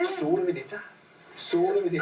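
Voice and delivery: high-pitched, playful Cockney; Cockney accent. Acted. Host echoes the podcast's name, high Cockney accent. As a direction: put on a high Cockney voice for the title. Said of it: "it sounds very Cockney, doesn't it?".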